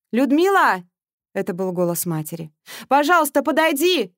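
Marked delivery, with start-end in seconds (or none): 0.1-0.8 higher, louder call across the room. Acted. Mother calls her daughter. 2.9-4.1 higher, louder plea from afar; calling out. Acted. Mother calls her daughter.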